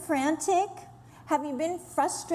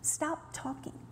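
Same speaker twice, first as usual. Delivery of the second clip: much softer, whispered gentle call; 11 dB quieter than usual. Retold or imitated. Jesus quieting the speaker's complaining, in a hushed whisper. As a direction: hush her with a tender whisper. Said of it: "I've heard him say it to me".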